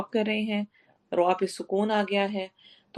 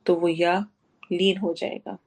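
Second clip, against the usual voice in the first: ordinary talk, nothing marked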